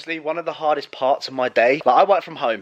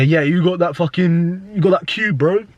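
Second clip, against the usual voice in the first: mock a junkie asking for gear; lads' vlog, imitating a dealer in the toilet; 5 semitones higher than usual. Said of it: "you just hear".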